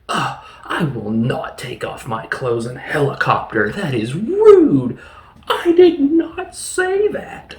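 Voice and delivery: mock indignation. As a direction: protest like you've been scandalously accused. Acts indignant council telepath protesting.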